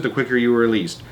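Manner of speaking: ordinary delivery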